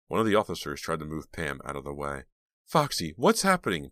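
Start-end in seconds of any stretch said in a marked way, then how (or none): 2.7-3.9 high, urgent demand. A narrator's reading. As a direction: insist on an answer. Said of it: "Pam demanded".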